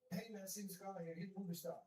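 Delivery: high, fast put-on dude voice; mocking dude voice. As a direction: mimic a casual guy.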